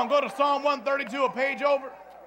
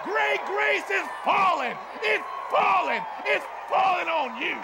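high, shouted revival cadence; full-force preaching; 7 semitones higher than usual. Real, not acted. Preacher promising great grace and victory, fervent shouted preaching. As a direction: drive each phrase out to the crowd.

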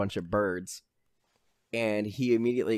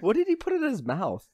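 surprised question; 11 semitones higher, 3 dB louder than usual. Real, not acted.

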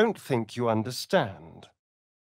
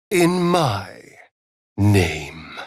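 louder, a commanding claim; fierce declaration; 4 semitones lower, 7 dB louder, much slower than usual. Acted. Vampire companion claiming something in his name, loud and commanding. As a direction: plant the words like a vow.

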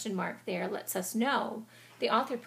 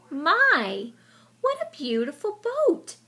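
high, loud gasp of wonder; amazed delight; 8 semitones higher, 12 dB louder, slower than usual. Acted. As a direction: marvel at the boat. Mouse amazed by the boat.

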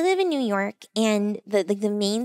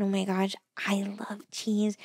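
hushed cheese confession; whispered gush; 3 semitones lower, 7 dB quieter than usual. Real, not acted. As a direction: whisper it like a secret love. Gushing about cheese.

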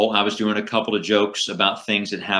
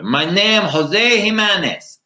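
put-on high, slow Cuban comedy accent; Jose Jimenez; 11 semitones higher, 4 dB louder, slower than usual. Impersonating an old comedian's act, high drawn-out Cuban accent. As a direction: stretch the name out in a loud Cuban accent. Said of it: "who would do this Cuban character".

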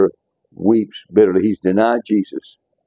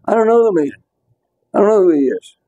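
high, heated mock denial, voice pitched way up; cursing denial; 11 semitones higher than usual. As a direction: play Peter swearing he never knew him. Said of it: "So he's beginning to curse now".

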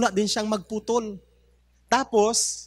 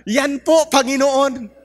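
greedy eager claim; 7 semitones higher, 7 dB louder, slower than usual. Acted. Greedy neighbor claims gold axe.

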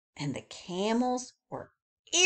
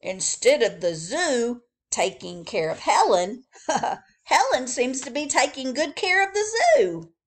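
louder, laughing father; amused father; 5 semitones higher, 6 dB louder than usual. Acted. Father laughing to Billy about Helen, louder. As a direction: chuckle through the irony. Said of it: "said his father".

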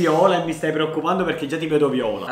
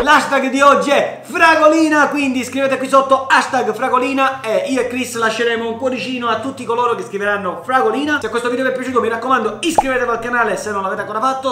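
YouTuber outro; 7 semitones higher, 5 dB louder than usual. Acted.

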